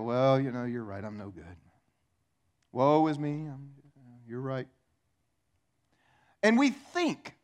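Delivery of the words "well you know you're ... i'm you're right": woe is me. Retold or imitated. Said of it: "And go".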